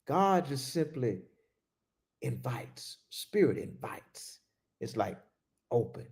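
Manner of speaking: very soft, near-breathless invites; hushed. Shown as a demonstration. Preacher contrasting gentle God with the shouting ego, hushed. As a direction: let the voice fall away to almost nothing.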